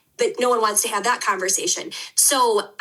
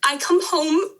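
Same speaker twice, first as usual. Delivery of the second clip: real tears rising, higher voice; close to crying; 6 semitones higher than usual. Real, not acted. Podcast guest telling her family's mold story, close to crying. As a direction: choke up as the tears come. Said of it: "didn't think I was gonna cry".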